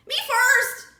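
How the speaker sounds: loud high shout; crying out. A narrator's reading. Piglet yelling to be first in line. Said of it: "he cried at story time".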